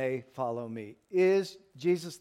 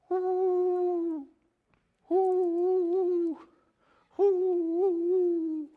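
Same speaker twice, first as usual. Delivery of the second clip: long, high, steady hoot; imitated whale call; 13 semitones higher, much slower than usual. Preacher mimics a whale on a recording, whale call. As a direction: draw out a high, hollow whale song. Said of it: "He hears that whale going".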